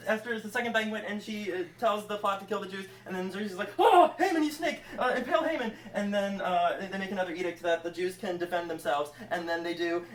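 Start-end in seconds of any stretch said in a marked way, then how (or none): 3.8-5.7 high, loud mock outrage; outraged king. Xerxes learning of the plot, mock outrage. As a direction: gasp it out in hammed-up indignation.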